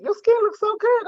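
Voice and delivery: high, bright compliment voice; admiring friend voice. Retold or imitated. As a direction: gush over her skin. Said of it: "it was like".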